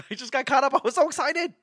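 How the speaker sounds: very high, giddy mock excuse; imitating excited wife. Wife excusing her rushed table choice, imitated excitement. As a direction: squeal it as an overexcited wife. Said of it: "that's how excited my wife was".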